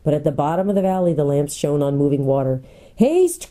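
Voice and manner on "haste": high, urgent cry. A narrator's reading. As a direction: call out the order urgently. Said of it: "cried the prince".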